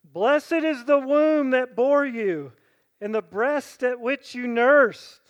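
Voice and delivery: loud, slow proclaiming call; woman in the crowd calls out to Jesus. A narrator's reading. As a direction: lift the voice over a crowd. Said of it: "raised her voice and said to him".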